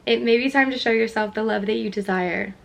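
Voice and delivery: normal talking voice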